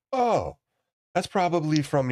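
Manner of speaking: high-pitched imitation of the coworker. Teller mimics Nigerian coworker asking about the call, high mock voice. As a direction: pitch way up to play him. Coworker quoted via he goes.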